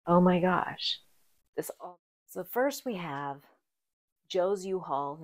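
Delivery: barely above a breath, overcome; hushed, moved. Real, not acted. Almost crying over grandfather.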